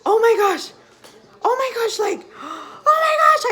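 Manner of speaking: high, loud, gasping disbelief; replaying the moment of disbelief, high and loud; retold excited yelling. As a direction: shriek it out in shock at the second line. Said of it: "I started, like, yelling".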